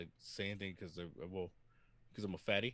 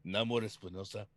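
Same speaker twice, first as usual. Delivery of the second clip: playful Spanish pronunciation of the name; Spanish accent. Pretend. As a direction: say "Namor Espinosa" as nah-MOR, in a Spanish accent. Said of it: "I had to say it with the accent".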